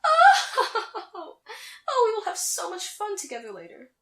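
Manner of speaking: high, haughty laugh into speech; gloating laughter. Acted. Naga taunting Lena about fun with her new partner, gloating laugh.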